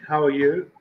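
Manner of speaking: ordinary delivery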